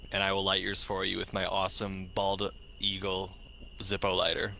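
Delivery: ordinary delivery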